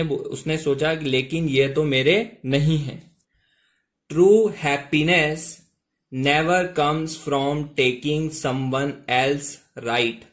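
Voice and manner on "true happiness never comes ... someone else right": very slow, word by word English; slow model reading. Shown as a demonstration. Teacher to students, reading the sentence very slowly. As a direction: read it out slowly for the learners. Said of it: "ध्यान से देखिएगा दोस्तों".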